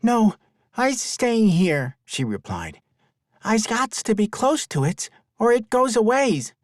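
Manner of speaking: put-on high, firm little girl; a child standing her ground; young girl voice. Girl refuses to leave.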